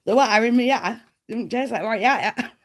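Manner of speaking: giggling mock advert voice; laughing through the quote. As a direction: try the advert line again but crack up. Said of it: "I'm probably saying it totally wrong".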